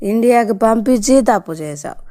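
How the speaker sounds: usual speaking voice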